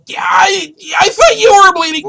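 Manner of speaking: high, loud, near-crying stammer; husband yelling his confusion; panicked shout. Retold or imitated. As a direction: stammer it out in a frenzy. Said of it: "And I was like".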